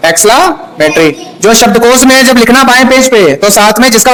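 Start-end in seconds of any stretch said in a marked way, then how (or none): 0.1-1.2 slow, lower एक्सक्लेमेटरी split as "ex-cla, metry"; teacher spacing out एक्सक्लेमेटरी for repetition; syllable-by-syllable pronunciation. Shown as a demonstration. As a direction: break एक्सक्लेमेटरी into two slow chunks. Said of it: "क्या बोलते हैं?".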